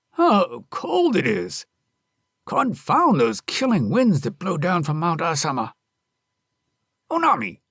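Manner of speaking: husband cursing the bitter wind; gruff husband. Acted. Husband grumbles at cold.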